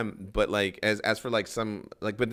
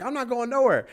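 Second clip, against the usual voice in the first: high-pitched imitation of a stubborn woman; high, mock-defiant retort; defiant refusal; 13 semitones higher, 4 dB louder than usual. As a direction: mimic her digging in, pitched way up. Retold woman refuses to leave.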